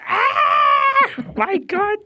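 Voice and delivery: very high, loud squeal; tipsy shriek over her accent; squealing excitement. Retold or imitated. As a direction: shriek with delight. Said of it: "And I was like".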